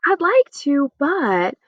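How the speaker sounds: high, slow, hesitant "but"; reluctant decline. Shown as a demonstration. Teacher acts a reluctant refusal, high and slow. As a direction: trail off regretfully. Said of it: "You could say".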